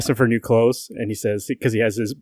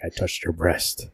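low, slow, quiet mock confession; lowered voice, drawn out softly; brooding Batman; 6 semitones lower, 7 dB quieter, slower than usual. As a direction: imitate a hero brooding to himself. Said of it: "he's like".

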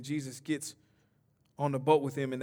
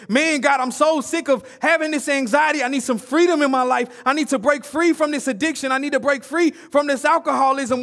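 loud, high, pleading cry of a burdened person; desperate worshipper's plea, preached; voicing a struggling listener; 10 semitones higher, 10 dB louder than usual. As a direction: cry out like someone desperate for freedom. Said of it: "that you're thinking".

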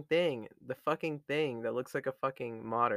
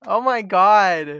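loud, slow shocked exclamation; 4 semitones higher, 12 dB louder, much slower than usual. Real, not acted. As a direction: drag it out, scandalized. Reacting to crude remark.

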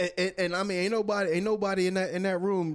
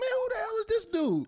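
much higher, incredulous mock voice; baffled inner voice. Player mocking his own shock at Doc, much higher. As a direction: squeak it out in disbelief.

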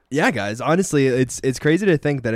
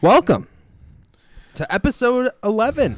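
announcer voice; 11 semitones higher, 4 dB louder than usual. Acted. Podcast intro announcement.

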